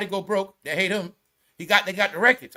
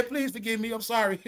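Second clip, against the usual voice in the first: much softer, meek little plea; mock pleading hush; 8 dB quieter than usual. Mocking a woman who now begs Trump, soft and meek. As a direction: go small and quiet, begging forgiveness. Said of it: "she was saying".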